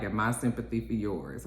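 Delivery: low, softly delivered reply; subdued acted comeback; muted retort. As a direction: drop it low and quiet, as a parting retort.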